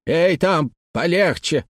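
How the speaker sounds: a restrained narrated shout at the workers; calling out. A narrator's reading. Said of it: "закричал на рабочих Хэммонд". (окрик рабочим высоким голосом)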